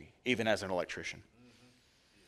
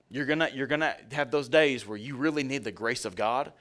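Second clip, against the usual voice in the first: usual speaking voice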